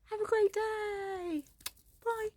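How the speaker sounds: high, slow sing-song goodbye; cheery sign-off. Real, not acted. Ending the vlog, cheery high slow goodbye.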